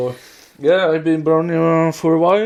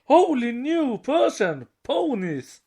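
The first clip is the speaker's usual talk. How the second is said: mock hero exclaim; 9 semitones higher, slower than usual. Reading show quotes aloud.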